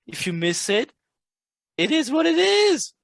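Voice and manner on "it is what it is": resigned shrug. Acted. Shrugging off a missed trade.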